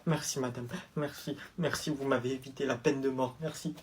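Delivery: hushed relieved thanks. Acted. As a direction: whisper your thanks in relief. Élève soulagé remercie tout bas. (élève soulagé remercie la prof tout bas)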